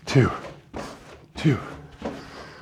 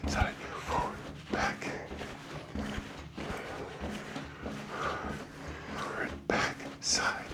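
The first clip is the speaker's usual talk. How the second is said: whispering; 3 semitones higher, 8 dB quieter than usual. Real, not acted. Counting steps under breath.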